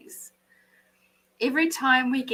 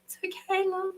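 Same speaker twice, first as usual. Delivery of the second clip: whispered pitying comfort, high and soft; mock coddling; 9 semitones higher, 6 dB quieter than usual. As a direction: imitate a fussing supporter, breathy and pitying. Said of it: "people there going".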